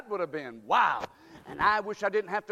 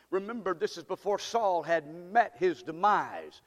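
normal talking voice

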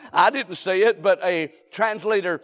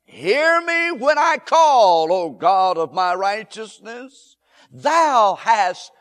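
loud cry to God; 6 dB louder, slower than usual. Acted. Preacher crying out the psalm to the congregation, loud plea. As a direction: cry out the psalm with full force.

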